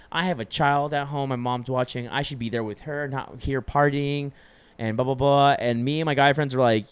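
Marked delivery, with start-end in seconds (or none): none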